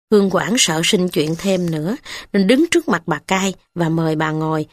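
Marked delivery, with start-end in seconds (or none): none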